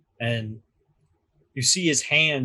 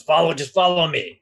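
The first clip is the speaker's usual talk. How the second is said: put-on louder Severosi accent, insistent; 4 semitones higher, 6 dB louder than usual. Doorman urging the crew onward in a louder Severosi accent. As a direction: push them along in a Severosi accent. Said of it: "He says".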